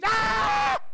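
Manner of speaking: loud, high mock scream of fright; sudden acted yelp of alarm; startled scream. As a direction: scream as if tapped on the shoulder. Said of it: "We scream so loud".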